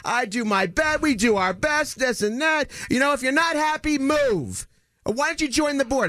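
high, mock whiny complaining voice, rising to a shout; imitating the president's high exasperated rant; mocking shrill board president. [name] mimics the board president snapping at him, high and whiny.

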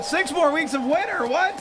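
can't believe the news; dismayed surprise. Real, not acted. Host reacts to bad forecast.

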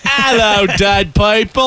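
hammed-up Aussie hello; Australian accent. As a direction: play along in broad Australian. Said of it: "Australians do a great".

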